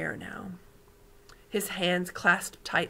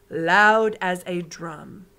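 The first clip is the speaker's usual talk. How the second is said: each word drawn out, louder; slow emphasis; 9 dB louder, much slower than usual. Acted. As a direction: drum out the words slowly. Giant stresses heartbeat.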